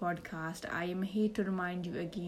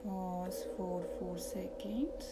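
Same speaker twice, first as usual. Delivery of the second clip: soft, slow meditation voice; calm, hushed, lead them into relaxation; guided meditation; 8 dB quieter than usual. Acted.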